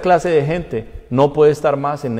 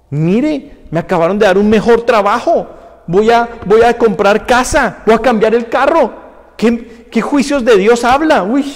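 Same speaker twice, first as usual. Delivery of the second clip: raised, emphatic boasting and ridicule; mock scoffing congregant; 8 semitones higher, 5 dB louder than usual. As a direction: imitate a dismissive member in a higher voice. Acts out scoffers mocking the pastor.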